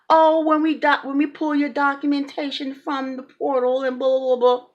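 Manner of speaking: dismissive imitation of a bureaucrat; mocking office staff. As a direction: parrot the official excuse, trailing into blahs. Mimics staff's stalling answer.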